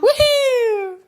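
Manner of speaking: high, loud laughing cheer; potty training praise. Real, not acted. Mom cheering toddler.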